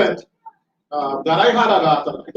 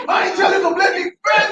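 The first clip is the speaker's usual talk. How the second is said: high, fast, laughing imitation of a loud coach; 13 semitones higher, faster than usual. Recalling the coach yelling in the huddle, high laughing mock bellow. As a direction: mimic the coach booming at his player, half laughing. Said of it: "because he would talk so loud".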